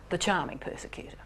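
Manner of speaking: whispered softly in warning. Shown as a demonstration. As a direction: hush it like a secret warning. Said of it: "beware of".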